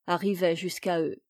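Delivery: ordinary delivery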